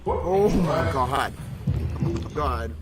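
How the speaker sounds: stunned gasp. Real, not acted. Stunned streamer reacts to verdict news.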